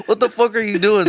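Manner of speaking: high-pitched incredulous quote; hammed-up startled protest; mock outrage. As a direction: imitate someone demanding an answer, high. Acting out a startled confrontation.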